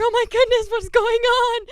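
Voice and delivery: high, loud panicked cry; freaking out. Acted. Girl panicking over her broken finger by the lake, loud high panic. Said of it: "So I started freaking out".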